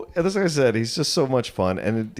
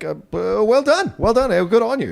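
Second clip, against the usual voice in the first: higher-pitched, awkwardly impressed well-wishing; mock congratulation; 13 semitones higher, 4 dB louder than usual. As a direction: congratulate in a high, stunned voice. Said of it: "we're almost like".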